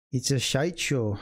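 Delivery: put-on a light, jokey try at a Scottish accent; a passing joke in a mock Scottish accent. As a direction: throw in a half-hearted Scottish twang, slowly. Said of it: "That was my attempt at a Scottish accent".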